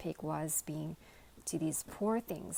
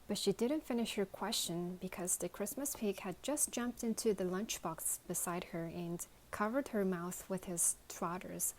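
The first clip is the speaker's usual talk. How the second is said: usual speaking voice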